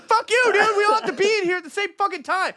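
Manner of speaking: high, fast mock outburst at the mom; retold outburst. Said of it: "I'm like".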